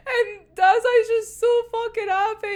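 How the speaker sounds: high, delighted squeal. Real, not acted. Fan describing how happy Dazai is, high and delighted.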